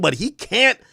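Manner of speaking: normal talking voice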